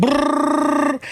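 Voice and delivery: high trilled whistle sound; mock whistle blast; imitated whistle. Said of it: "they would blow a whistle".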